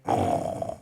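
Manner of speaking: low, rumbling mock snore; imitated snore. Person asked to face a memory dozes off, mock snore. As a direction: fall straight into a snore. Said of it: "Part that's sleepy".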